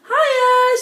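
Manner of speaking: put-on girl greeting the cat hairdresser, high and cheery. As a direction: bounce in with a bright greeting. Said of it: "she said".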